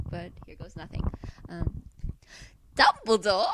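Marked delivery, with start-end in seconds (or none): 2.8-3.5 loud puppet-show voice; Dumbledore impression. Apologetic fan attempting Potter Puppet Pals, loud Dumbledore. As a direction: call out the name like a puppet character. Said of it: "botching the Dumbledore voice".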